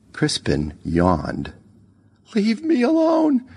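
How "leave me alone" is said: long, very high desperate cry; shriek. A narrator's reading. Lisa to the ghost haunting her, shrieking. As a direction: shriek it high to drive him off. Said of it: "she shrieked".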